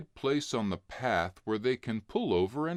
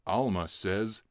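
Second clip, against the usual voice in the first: usual speaking voice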